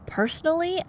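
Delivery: ordinary delivery, nothing marked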